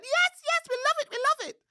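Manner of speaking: very high, loud, squealing monkey cry; thrilled little monkey spotting a visitor; excited monkey. A narrator's reading. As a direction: shriek with delight like a little monkey. Monkeys cheering the song.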